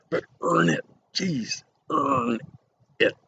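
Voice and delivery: earn jumps up high, leaned on hard; emphatic earn it. Real, not acted. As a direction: drive home the word earn. Stressing earning your enjoyment.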